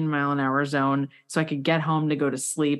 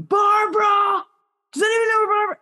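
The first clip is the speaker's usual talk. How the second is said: retold hallway shout; 5 dB louder than usual. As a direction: call down the hallway hunting for Barbara. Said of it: "the hallway going".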